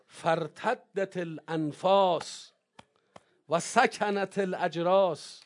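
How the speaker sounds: slow, stretched recitation of the line repeated; drawn out. Shown as a demonstration. Preacher repeating the Arabic line slowly for the congregation. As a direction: lean on each word slowly, letting it hang.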